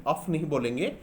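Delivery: normal talking voice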